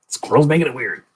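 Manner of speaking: high-pitched defensive excuse. Acted. Repeats the excuse, now insisting.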